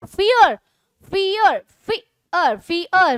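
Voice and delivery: Fear broken slowly into two beats, fi and yar; split syllables. Shown as a demonstration. Teacher showing two stresses, fear split into fi-yar. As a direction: pull fear apart, stressing both fi and yar. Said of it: "बोलते वक्त तोड़ना नहीं पड़ रहा। लेकिन".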